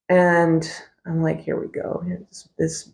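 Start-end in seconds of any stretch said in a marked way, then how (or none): 1.4-2.9 softer, half-whispered aside to herself; muttered thought. Retold or imitated. Sitting in church, thinking to herself: a soft, cynical mutter. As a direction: roll your eyes under your breath.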